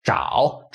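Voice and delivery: zhao with a dipping third tone; "zhao" in Mandarin tone three, dip and lift. Shown as a demonstration. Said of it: "third tone".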